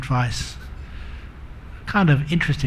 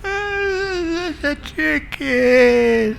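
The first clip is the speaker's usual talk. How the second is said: hammed-up, high-pitched, very drawn-out wail; mock tearful whine; 12 semitones higher, much slower than usual. Wife with watery eyes insists, comically close to tears. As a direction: whine it as if about to burst into tears. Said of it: "She was about to burst into tears".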